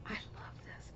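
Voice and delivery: breathy whisper, almost unvoiced; hushed private whisper of delight. Real, not acted. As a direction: murmur it to yourself. Whispered aside before voiced take.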